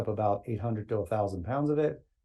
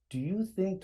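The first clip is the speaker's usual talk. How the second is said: imitating Martin's broken English with a Dutch accent, pitched higher; hammed-up Dutch accent with halting English; 9 semitones higher, 3 dB quieter than usual. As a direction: put on a thick Dutch accent, playing helpless. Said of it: "drops into his very broken English with a Dutch accent".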